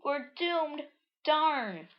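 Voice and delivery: high cartoon despair; hammed-up high dismay; doomed character. Characters react to monsters.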